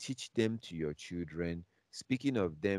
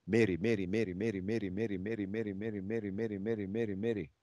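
mocking sing-song; 3 semitones higher, faster than usual.

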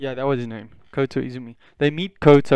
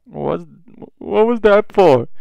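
high, drawn-out flustered question; flustered surprise; 7 semitones higher, 5 dB louder, slower than usual. Retold or imitated. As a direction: stumble in, then raise pitch and volume. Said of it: "kind of flustered at the same time".

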